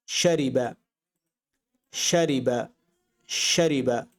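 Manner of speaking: শারিবা said in Arabic, word by word with a falling end; teacher repeats শারিবা clearly for students; Arabic pronunciation. Shown as a demonstration. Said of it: "আমি আরবি বলছি". (আরবি উচ্চারণে শারিবা)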